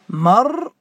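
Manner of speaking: مرموة said with a waw, marmuwa; مرموة with the ya turned to waw; pronouncing the word. Shown as a demonstration. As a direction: say مرموة as mar-mu-wa. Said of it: "ستقول هكذا".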